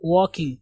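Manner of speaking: "वॉकिंग" with a silent l and a long open o, like wawking; correct walking. Shown as a demonstration. Teacher modelling to students: "वॉकिंग" said as wawking. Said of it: "एएल को ओ की आवाज देंगे".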